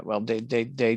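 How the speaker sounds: normal talking voice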